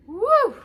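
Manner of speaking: high loud woo of relief; tired woo. A narrator's reading. Old man mopping his brow, loud high puff. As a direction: blow out like wiping sweat.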